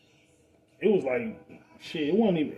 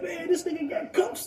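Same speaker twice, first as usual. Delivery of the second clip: much higher, hushed voice of the pump guy; whisper-gasp it like you just spotted cuffs; startled young man at the pump, pitched way up; shocked gas attendant. Retold or imitated. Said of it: "He said".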